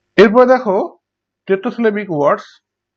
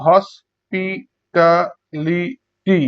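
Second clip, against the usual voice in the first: Hospitality very slowly in five beats; long gaps between syllables of hospitality; much slower than usual. Shown as a demonstration. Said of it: "পাঁচবার উচ্চারণ করা হয়".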